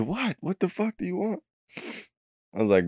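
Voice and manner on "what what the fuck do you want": a much higher, softer mock of a rudely woken friend; groggy annoyed friend. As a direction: play the half-asleep buddy snapping back. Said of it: "You said".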